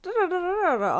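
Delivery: hammed-up 1940s newsreel jingle; old-timey newsreel voice. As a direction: sing it like an old TV news intro. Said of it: "like, old-timey news reporter voice".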